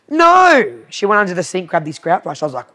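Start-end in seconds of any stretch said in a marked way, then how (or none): 0.1-0.8 high, loud, swooping denial; mock-exasperated correction. Retelling the client rejecting her hairbrush guess, exasperated.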